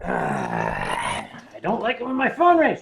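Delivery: usual speaking voice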